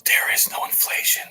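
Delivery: breathy mocking whisper of a politician; hushed parody whisper; mock Biden whisper. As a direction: whisper it like a creepy old politician.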